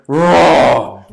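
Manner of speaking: loud mock roar; lion finally roaring. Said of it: "he went".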